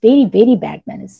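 very slow, each word stretched out; a drawn-out, teasing telling-off; slow mock scolding. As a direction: drag it out like a mock lecture. Embarrassed boy scolding playfully.

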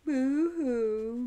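very high, loud hammed-up wail; cartoon boo-hoo sob; mock sobbing boo-hoo. A narrator's reading. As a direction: ham up a childish cry. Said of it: "Miss Mary started crying".

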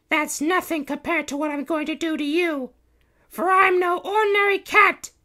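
very high, loud heroine voice; threatening princess. A narrator's reading. Princess Kitty warning the Baron, threatening.

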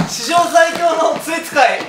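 slow, loud laughing proclamation; laughing shout. Real, not acted. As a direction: shout it grandly through laughter. Dizzy spinning, laughing boast. (笑いながら叫ぶ)

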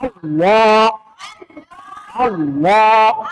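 আল্লাহ with a heavy, rounded, lengthened লা; thick pronunciation. Shown as a demonstration. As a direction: repeat আল্লাহ with a thick, full লা. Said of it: "মোটা করে যদি পড়ি". (আল্লাহ-র লা মোটা করে টেনে পড়া)

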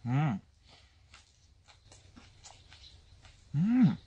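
chewing while humming; eating noodles; mouth full. Real, not acted. Seen 0: Face clear, mouth full, chewing and slurping noodles throughout the label.

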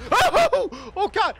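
high, loud cry of alarm; real panicked yelp; startled scream. Real, not acted. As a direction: shriek as if the ground just dropped away. Sudden scare mid-taunt in game.

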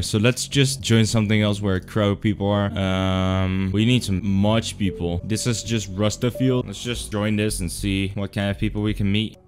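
ordinary delivery

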